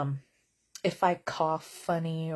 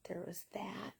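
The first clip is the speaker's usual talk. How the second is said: unvoiced, much softer whisper; whispered aside; 16 dB quieter than usual. Real, not acted. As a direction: mutter it under your breath. Deadpan aside.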